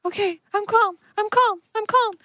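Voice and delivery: high voice repeating I'm calm; the nervous man keeps telling himself he's calm; high insisting-calm voice. Acted. Rescued man told to stay calm.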